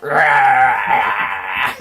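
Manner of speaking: loud low dinosaur roar. Retold or imitated. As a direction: roar like a T-Rex. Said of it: "He's just like".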